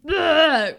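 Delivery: loud frustrated groan; loud annoyed ugh; exasperated ugh. Retold or imitated. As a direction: groan it out, fed up. Riley being violent, exasperated groan.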